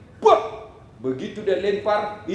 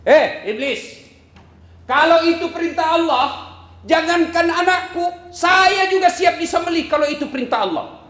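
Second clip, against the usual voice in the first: loud, higher, steadfast declaration; defiant shout; 10 semitones higher, 4 dB louder than usual. Acted. Siti Hajar rebuking the devil, defiant shout. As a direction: stand firm and shout it at the devil.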